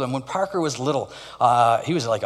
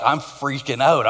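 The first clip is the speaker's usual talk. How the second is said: normal talking voice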